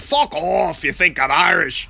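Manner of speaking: lightly put-on Manchester accent, mock retort; bad Manchester accent. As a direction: imitate the Manchester fan telling him off. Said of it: "That was probably a bad impression".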